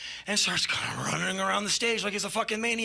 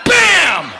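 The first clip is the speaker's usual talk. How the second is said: high, very loud "bam"; imitated smash; 11 semitones higher, 13 dB louder than usual. Iggy destroys a flower pot, shouted sound effect. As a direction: explode on the word like a kick.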